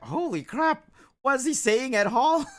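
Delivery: loud, high outburst breaking into laughter; baffled amazement. Retold or imitated. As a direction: act out stunned bafflement, cracking up. Retold bafflement at old man's speech.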